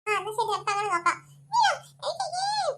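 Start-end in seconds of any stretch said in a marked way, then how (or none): 1.6-2.7 high, slow whimpering complaint; child whining at the game; whiny cry. Acted. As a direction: whine like a child about to cry. Child whines about counting.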